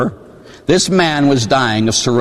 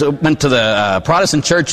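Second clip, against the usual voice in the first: ordinary talk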